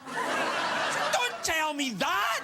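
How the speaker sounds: mock horror. Mock-horrified reply.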